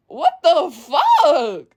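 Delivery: drawn-out, stunned "What the fuck"; slow disbelief. Retold or imitated. Reacting to someone who can't take advice, slow stunned disbelief. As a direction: stretch it out in disbelief.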